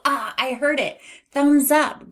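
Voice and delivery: high, loud burst of delight; excited discovery. Pretend. Teacher celebrates hearing the first sound, excited.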